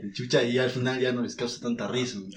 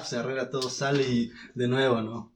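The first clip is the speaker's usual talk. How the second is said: usual speaking voice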